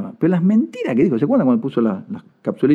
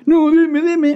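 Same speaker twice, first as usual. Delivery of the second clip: hammed-up greedy whine; whiny mock pleading; 12 semitones higher, much slower than usual. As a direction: beg like a spoiled child. Mocking governor demanding money.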